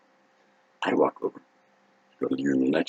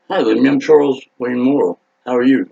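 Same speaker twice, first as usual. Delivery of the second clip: louder, higher, over-friendly hello; eager greeting; 5 semitones higher, 5 dB louder than usual. Retold or imitated. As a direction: mimic a big, eager introduction. Acted drunk greeting to idol.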